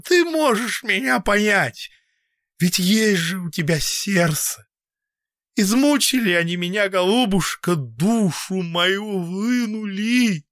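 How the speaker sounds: put-on high, loud, pleading old man. A narrator's reading. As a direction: beg her to understand you. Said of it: "стараясь смягчить свой резкий каркающий голос".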